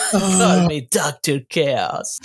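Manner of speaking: giggling villain line; can't keep a straight face; laughing through it. Retold or imitated.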